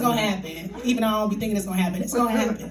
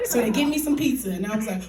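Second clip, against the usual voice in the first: ordinary delivery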